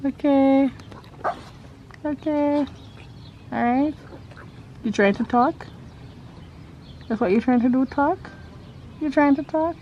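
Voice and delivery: high, sweet talk to the horse. Real, not acted. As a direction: coax the horse tenderly. Talking to the horse.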